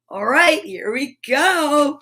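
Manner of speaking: ordinary talk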